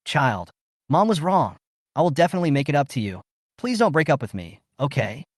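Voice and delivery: plain reading voice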